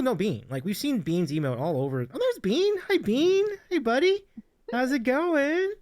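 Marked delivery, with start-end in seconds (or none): 2.0-5.7 high, sweet voice for a pet; cooing pet talk. Acted. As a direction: coo at the dog like baby talk. Greeting the pet on stream.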